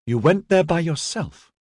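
high, shocked question; disapproving. A narrator's reading. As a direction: query it with clear disapproval. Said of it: "Kanzine asked disapprovingly".

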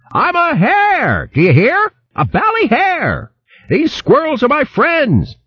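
put-on high, blustering old hare declaring himself; old hare yelling. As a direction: bellow it out like a pompous old hare. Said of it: "Fleetscut yelled at the top of his voice".

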